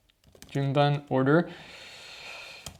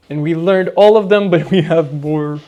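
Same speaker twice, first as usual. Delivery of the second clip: excited; 13 dB louder, faster than usual. Real, not acted. Excited about finishing the reviews.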